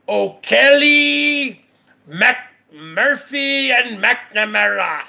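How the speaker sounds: drawn-out mock Irish lilt on each channel name; mock Irish accent. Mocking a rival's Irish channel names in a fake Irish accent.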